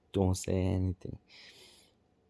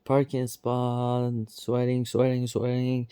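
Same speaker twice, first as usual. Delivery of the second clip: mock angry yell; 4 semitones higher, 6 dB louder, slower than usual. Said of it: "loudly again swearing".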